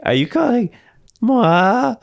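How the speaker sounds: high squeaky mock-teen indignation; broken adolescent voice. Host mimics young John challenging an insult, broken voice. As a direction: squeak it, dragging out the offended word. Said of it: "a bit of a hit of that voice".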